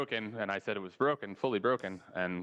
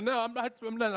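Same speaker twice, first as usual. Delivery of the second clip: put-on whiny kid refusing; 11 semitones higher than usual.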